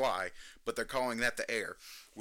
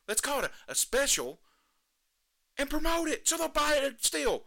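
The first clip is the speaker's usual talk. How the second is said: high, loud incredulous tirade; ranting; 10 semitones higher, 3 dB louder than usual. Real, not acted. Podcast host raging at McDonald's marketing ploy, high loud incredulous rant. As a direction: push the outrage up, scoffing at how dumb it is. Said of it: "I'm done ranting. I'm done raving".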